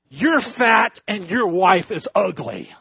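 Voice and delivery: high, loud squawked insult; rude parrot. Acted. Parrot insulting the husband and wife, loud high squawk. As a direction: jeer it like a cheeky bird.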